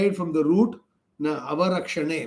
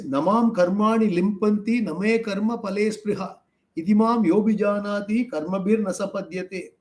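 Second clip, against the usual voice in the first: verse chanted slightly higher; Gita verse recitation; 4 semitones higher than usual. Shown as a demonstration.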